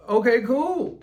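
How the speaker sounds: high, loud falsetto okay cool; squeaky sarcastic agreement; mock cheerful. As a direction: chirp it in mock brightness. Chicken answer, mock cheerful.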